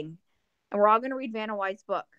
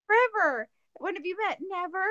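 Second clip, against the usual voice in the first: high-pitched quoted exchange; acted sitcom cousins; 9 semitones higher than usual. Fan reenacting cousins' first meeting, high acted voice. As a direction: play both cousins well above your own pitch.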